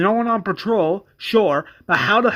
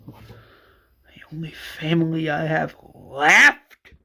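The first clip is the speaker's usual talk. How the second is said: slow whispered grief; Johnny whispers about losing his only family; broken whisper; much slower than usual. Acted. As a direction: breathe the words out, hurt. Johnny grieving.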